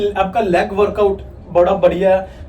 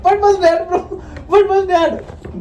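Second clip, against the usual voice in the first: surprised; 11 semitones higher, much slower than usual. Real, not acted. Mock outrage at the take.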